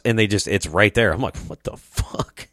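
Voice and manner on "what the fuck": slow, whispered disbelief; stunned whisper. Retold or imitated. Friend reacting to a roadside gun sale, stunned whisper. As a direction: whisper it slowly, as if dumbfounded.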